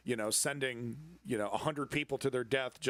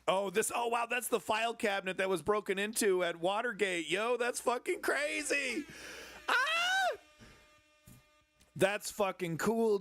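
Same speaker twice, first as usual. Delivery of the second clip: real excitement; 7 semitones higher than usual. Real, not acted. Excited host discovering the Watergate file cabinet, gushing.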